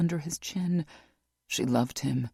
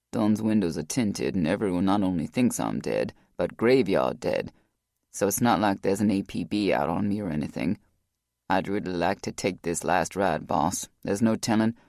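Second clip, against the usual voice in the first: lower, heavy-hearted man making up his mind; Rock, low and resolved; 7 semitones lower than usual. A narrator's reading.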